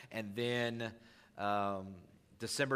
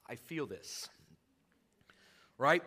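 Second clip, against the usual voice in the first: usual speaking voice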